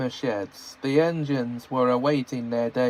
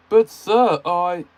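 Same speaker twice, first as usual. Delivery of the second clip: high, loud cut-off protest; protesting Gordon; 5 semitones higher, 6 dB louder, slower than usual. Acted. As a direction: start to object. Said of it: "started Gordon again".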